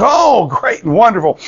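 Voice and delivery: high-pitched mock delight; delighted exclamation. Old preacher hears the foreigner is saved, acted delight. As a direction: swoop up high on an enthusiastic "oh".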